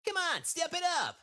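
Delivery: very high, loud cartoon hero; Sonic. Urging a rival on, high loud character voice. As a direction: shout it high like a cocky game hero. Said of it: "I can probably do a Sonic".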